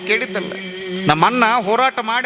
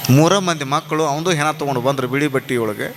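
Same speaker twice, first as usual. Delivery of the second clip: low, grave tale of grief; solemn storytelling; 8 semitones lower than usual. A narrator's reading. Preacher telling of a bereaved mother's weeping, solemn. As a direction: let each phrase sink, low and heavy.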